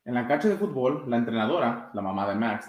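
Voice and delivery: usual speaking voice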